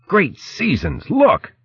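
slow, high-pitched amazement; astonished exclamation. Acted. Mouse sighting the emptied shore, high astonished cry.

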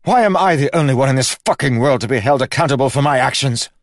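high, loud, fast raging shout; loud fast furious yell; furious outburst. Acted. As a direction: rage at the whole world.

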